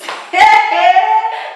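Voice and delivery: high loud forced laugh; loud deliberate laugh. Real, not acted. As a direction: laugh out big on purpose. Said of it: "Let me just start laughing".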